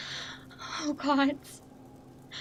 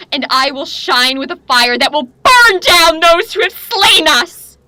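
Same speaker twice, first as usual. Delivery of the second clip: fierce vow; 3 semitones higher, 6 dB louder than usual. Acted. Vow of vengeance.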